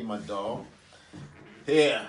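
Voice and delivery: ordinary delivery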